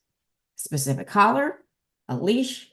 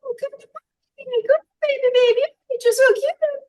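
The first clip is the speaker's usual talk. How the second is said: much higher, cooing dog-owner voice; high girly baby talk; 5 dB louder than usual. Retold or imitated. Trainer mocking owners who baby-talk their dogs, high girly coo. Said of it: "in a high girly voice".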